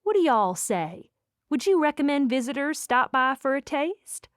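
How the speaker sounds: inviting Southern interviewer voice; charming Southern accent. A narrator's reading. Interviewer asking for a recommendation, charming Southern accent. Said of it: "said with a charming Southern accent".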